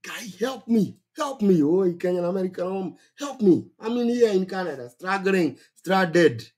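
imitating a stranded migrant whining help me; pleading stranded migrant. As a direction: beg like a panicked newcomer stuck abroad. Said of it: "that is when I get inboxes".

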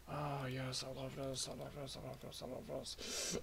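very soft, repeated murmur of delight; hushed glee. Real, not acted. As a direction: gush under your breath to yourself. Quiet glee as game works.

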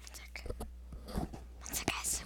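hushed aside; whispering. Real, not acted. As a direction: whisper it so the mic misses it. Aside while mom calls.

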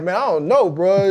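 mock-baffled man; bewildered. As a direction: throw up your hands, baffled. Laughing retelling of worry.

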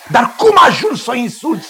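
high-pitched, outraged demand; indignant scolding. Acted. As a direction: demand an explanation, scandalised. Father scolds man for insulting daughter. (mustrare indignată, pe ton ridicat)

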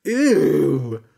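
loud ew; frog disgusted. A narrator's reading. Frog recoiling at hay, loud ew. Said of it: "said the frog".

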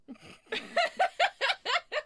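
high shrieking laugh. Real, not acted. Friend laughs at valley girl.

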